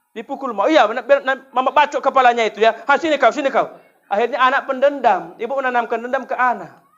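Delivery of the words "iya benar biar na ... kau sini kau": loud, high mock threat; hammed-up mother calling for a fight; furious mother. Mother threatening the other child. (ibu mengancam dengan suara keras)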